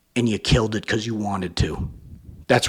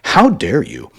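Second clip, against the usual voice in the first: louder mocking indignation; louder mock of wounded pride; mock narcissist; 3 semitones higher, 7 dB louder than usual. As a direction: repeat the huff as a sneer. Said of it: "something a lot of narcissists like to say".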